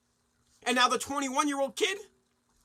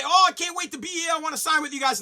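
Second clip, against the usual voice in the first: loud, high fake enthusiasm; mock eager player; 5 semitones higher, 4 dB louder than usual. Mocking a star pretending to love the city, loud fake gush. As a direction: gush it like a phony recruit. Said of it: "You, you say".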